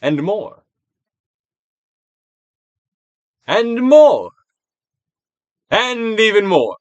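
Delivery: from a normal nudge up to a loud high call; building urging. Acted. Urging more release, escalating.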